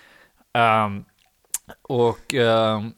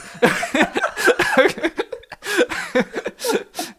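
long high burst of laughter; big laugh; 6 dB louder than usual. Real, not acted. After his Tinder punchline, real high laugh. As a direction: crack up fully.

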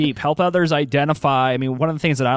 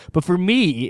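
usual speaking voice

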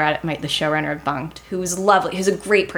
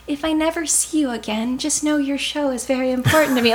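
high, overeager sign-off; mock-earnest goodbye, pitched way up; gushing farewell; 9 semitones higher than usual. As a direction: gush it out a little too sweetly. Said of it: "I even left being like".

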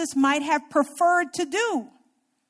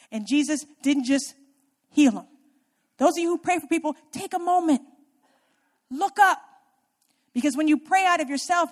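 normal talking voice

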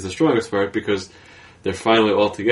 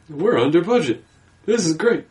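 high, gleeful studio boss; mock excited exec; 8 semitones higher than usual. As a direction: cheer like a cheap producer. Mocking studio execs.

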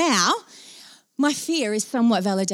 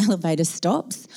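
ordinary talk, nothing marked

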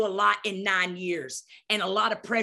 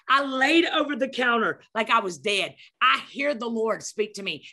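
normal talking voice

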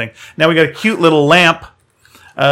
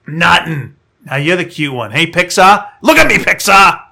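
loud, higher, bitter rant; jealous droid; 4 semitones higher, 5 dB louder than usual. Acted. Voicing jealous R2-D2.

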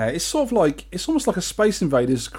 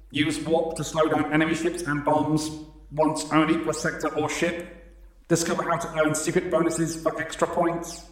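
even, softer robot voice reading game instructions; 3 dB quieter than usual. Pretend. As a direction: read it out like an arcade machine robot. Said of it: "I like doing robot voices".

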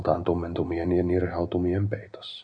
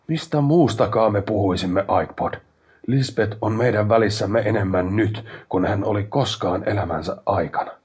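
heated retort; 3 semitones higher, 6 dB louder than usual. A narrator's reading. Brother argues back in quarrel.

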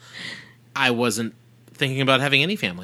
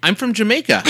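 put-on Jamaican accent; 8 semitones higher, 6 dB louder than usual. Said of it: "[name]'s version of a Jamaican accent".

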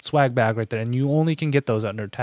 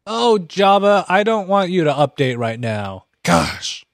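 exasperated; 7 semitones higher, 4 dB louder, slower than usual. Real, not acted. Annoyed at software update popup.

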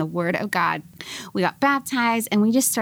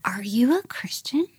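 half-whispered, higher-pitched question; hushed disbelief; 4 semitones higher than usual. Retold or imitated. Girlfriend asking boyfriend at a party, in a hushed whisper. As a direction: whisper it like a risky secret.

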